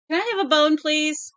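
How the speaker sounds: very high, loud pleading dog voice; voicing the dog. Owner voicing her dog begging for a bone, very high. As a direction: ask for a bone as the dog would.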